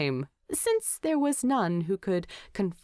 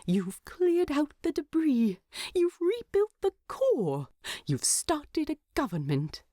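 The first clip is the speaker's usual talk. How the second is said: higher, earnest hobbit voice with giggles; tipsy hobbit; 7 semitones higher than usual. A narrator's reading. Bilbo fondly lists Thorin's achievements at the feast. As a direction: cheer him on, a bit drunk. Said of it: "trying to have a nice moment".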